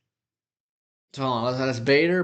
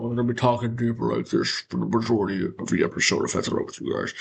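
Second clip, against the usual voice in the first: deeper voice; 3 semitones lower, faster than usual. Shown as a demonstration. Host promising viewers the deeper voice all episode, lower and quick. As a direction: hold the voice down deep and hurry through.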